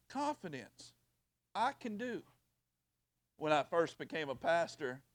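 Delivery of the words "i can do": ordinary delivery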